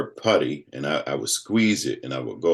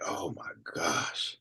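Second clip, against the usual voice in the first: hushed dread; 10 dB quieter, much slower than usual. Retold or imitated. Dawning dread, quoted.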